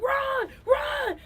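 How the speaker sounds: high urgent shout. Retold or imitated. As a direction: yell at the runner to go. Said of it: "She was like".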